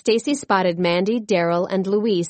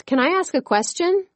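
high, eager call to the coach; 10 semitones higher than usual. A narrator's reading. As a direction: call out from the bleachers. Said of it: "as she called out".

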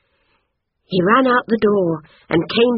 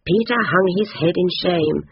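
ordinary delivery

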